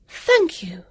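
put-on high bright young girl; a high girlish thanks; young girl's quick thank-you. A narrator's reading. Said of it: "said Vivian".

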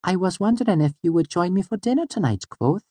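high, serious, dainty voice; Auri. A narrator's reading. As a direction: invite him formally in a small high voice. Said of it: "she said, her face serious".

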